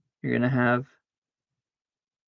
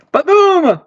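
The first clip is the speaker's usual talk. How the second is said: much higher, loud victory yell; triumphant boom; 14 dB louder than usual. Real, not acted. Landing the move with a high loud yell. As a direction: shout it like a winner.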